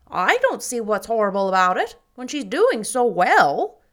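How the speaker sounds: emphatic Mrs Lynde. Acted. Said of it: "said Missus Lynde emphatically".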